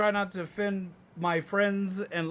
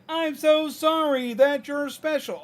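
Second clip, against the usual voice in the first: high, slow mock sympathy; overdone pitying sorry; loud obnoxious pity; 7 semitones higher, 3 dB louder, slower than usual. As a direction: imitate the syrupy condescension. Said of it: "And it's like".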